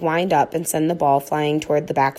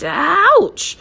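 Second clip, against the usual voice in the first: sudden pain; 14 semitones higher, 6 dB louder than usual. A narrator's reading. Leash yanks dog.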